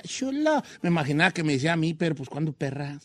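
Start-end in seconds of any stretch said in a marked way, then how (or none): 0.0-0.6 soft, higher flirty "chulo"; sensual catchphrase. Retold or imitated. Recalling the line they loved, soft sensual imitation. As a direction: coo it softly and seductively. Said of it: "Él lo decía bien sensual".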